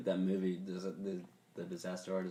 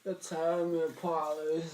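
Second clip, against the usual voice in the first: high, slow, drawn-out movie quote; hammed-up odd actor; Tommy Wiseau impression; 6 semitones higher, much slower than usual. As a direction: stretch it out in a put-on actor voice. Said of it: "a whole segment I have called impressions".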